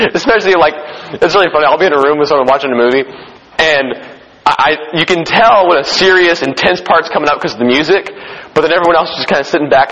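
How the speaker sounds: normal talking voice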